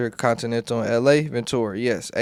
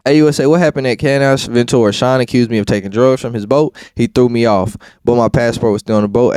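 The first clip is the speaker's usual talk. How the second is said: loud, steady courtroom read-out; transcript reading; 8 dB louder than usual. Acted. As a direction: read the testimony out like a clerk. Reads court transcript aloud.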